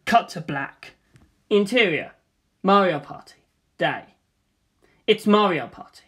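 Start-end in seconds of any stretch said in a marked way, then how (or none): none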